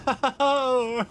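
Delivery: high laugh trailing off the cry; pained laughter. Real, not acted. Ambushed with the puns, high helpless laughter. Reaction to the bee pun.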